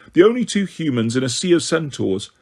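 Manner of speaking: usual speaking voice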